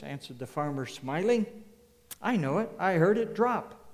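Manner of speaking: loud, rising sermon storytelling; building pulpit fervor; preaching at full force. Acted. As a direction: preach it out to the whole hall. Preacher tells the soup story.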